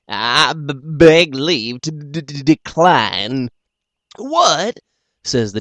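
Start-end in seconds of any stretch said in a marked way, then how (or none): 0.1-3.5 slow, halting stammer; stuttering. Acted. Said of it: "stuttering just a little". 4.2-4.8 high, loud what; playfully astonished; mock surprise.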